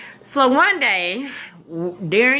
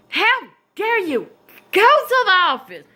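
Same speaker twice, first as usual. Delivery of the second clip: high, indignant order to the office; the teacher sending me to the office, high and indignant; outraged teacher; 10 semitones higher, 4 dB louder than usual. Retold or imitated.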